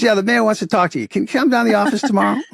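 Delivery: rushed Boston local with news; Boston accent. Retold or imitated. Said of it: "I'll play the Boston accent as much as I can".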